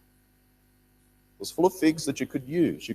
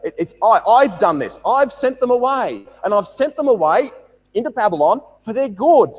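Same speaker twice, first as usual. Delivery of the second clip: higher, louder preaching of God's purpose; emphatic preaching; 7 semitones higher, 7 dB louder than usual. Acted. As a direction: declare it with fervour. Preacher voices God's plan emphatically.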